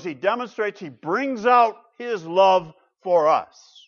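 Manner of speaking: mounting sermon fervour; preaching at full force. Acted. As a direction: drive it home to the congregation. Building toward the gospel point.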